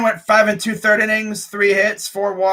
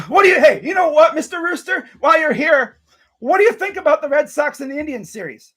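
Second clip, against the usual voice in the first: mock polite to rooster; 6 semitones higher than usual. Real, not acted. Sarcastically chatting up the rooster, mock polite. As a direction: tease the bird like a guest.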